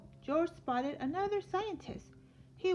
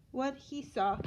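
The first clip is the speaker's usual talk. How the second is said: plain reading voice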